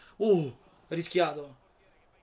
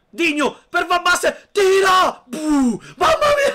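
excited shout; 12 semitones higher, 11 dB louder, slower than usual. Real, not acted. Goal chance during live match.

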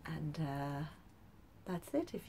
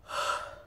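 loud, breathy storybook sigh; exaggerated exhale of dismay; heavy sigh; 5 dB louder than usual. A narrator's reading. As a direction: heave a big weary sigh. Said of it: "et soupira".

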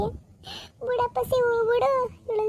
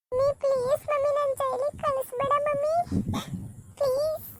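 pleading daughter; 6 semitones higher than usual. Acted. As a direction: beg mummy to spare you from jail, whimpering. Daughter begs not jail. (ಅಳುತ್ತಾ ಬೇಡುವ ಮಗಳು)

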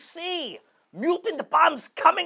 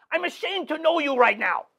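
ordinary talk

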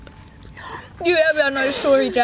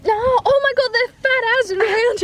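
laughing shriek; 9 semitones higher than usual. Real, not acted. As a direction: squeal through laughter. Reacting to big mosquitoes.

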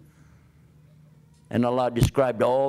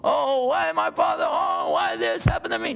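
mock anguished wailing; 12 semitones higher than usual. Preacher mimicking a grieving non-believer, high wailing cry. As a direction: cry out why my father in mock despair. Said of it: "the kind of crying that would have been".

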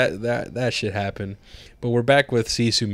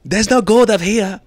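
high, loud goofy soldier; high, loud mock soldier through laughter; hammed-up soldier; 10 semitones higher, 6 dB louder, slower than usual. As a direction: bark it like a cartoon guard.

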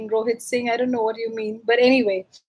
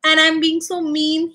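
usual speaking voice

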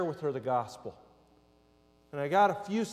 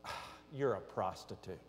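low, soft dawning realisation; hushed realisation; 8 semitones lower, 11 dB quieter than usual. Retold or imitated. Driver to passenger as the light goes on, hushed realisation. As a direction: let it slip out under your voice. Blurted realisation, acted quietly.

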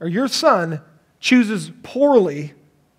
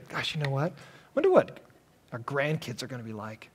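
much softer, dreamy musing aloud; wistful musing; 6 semitones lower, 12 dB quieter than usual. Retold or imitated. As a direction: drift off thinking of grandkids. Dreaming of grandkids.

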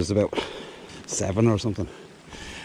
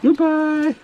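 high singsong goodbye; 5 dB louder than usual. Real, not acted. Releasing the eel.

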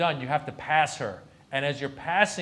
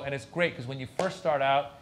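ordinary delivery

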